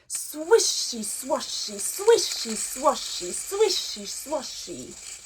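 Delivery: lively, sing-song picture-book reading; reading to children. Acted. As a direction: read it big for little listeners. Teacher reads the bear hunt story.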